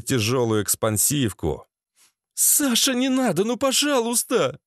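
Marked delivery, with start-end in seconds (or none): 2.3-4.6 high-pitched, imploring voice; pleading woman. A narrator's reading. Lena begs Sasha not to shoot, high imploring voice. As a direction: beg him in a raised feminine pitch. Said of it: "взмолилась Лена".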